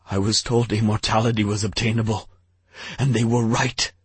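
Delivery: weeping king, voice rising. A narrator's reading. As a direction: plead through tears, rushing.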